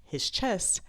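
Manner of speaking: normal talking voice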